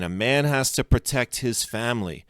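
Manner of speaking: plain reading voice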